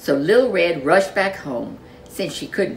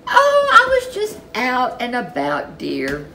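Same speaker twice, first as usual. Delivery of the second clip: lightly voiced granny; 7 semitones higher, 4 dB louder than usual. A narrator's reading. Granny answers with a laugh.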